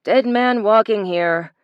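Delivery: slow, distorted voice over the radio; crackly radio call, drawn out; muffled radio voice. A narrator's reading. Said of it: "distorted and echoing as it came from two of their radios".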